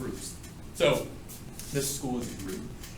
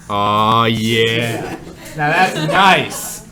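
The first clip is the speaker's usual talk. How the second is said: hammed-up appreciative aw yeah, louder; mock sensual; 13 dB louder, much slower than usual. Teacher joking to class about drawing thick N, hammed-up relish. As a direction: ham up admiring the letter.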